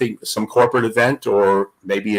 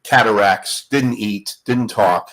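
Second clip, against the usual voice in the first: ordinary talk, nothing marked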